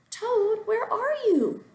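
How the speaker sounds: high calling shout; shouting Frog. A narrator's reading. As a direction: call him out of the house. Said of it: "called Frog".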